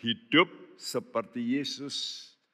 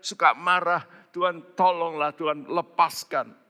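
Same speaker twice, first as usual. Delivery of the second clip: high, pleading preacher's cry; high impassioned sermon voice; fervent preaching; 7 semitones higher than usual. Acted. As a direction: urge the congregation to pray hard. Preaching at full force.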